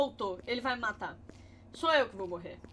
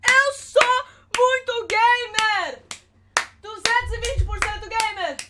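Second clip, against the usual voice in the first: hammed-up brag; 5 semitones higher, 5 dB louder, much slower than usual. Mock gamer bragging, drawn out.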